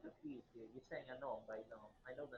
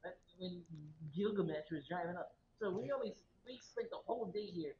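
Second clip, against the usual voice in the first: loud, higher indignant objection; loud protest; 4 semitones higher, 9 dB louder than usual. Real, not acted. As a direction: push back loudly. Player protests loudly.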